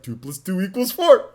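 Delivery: cartoon bear answering in class; Yogi Bear. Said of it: "talk like that the whole time".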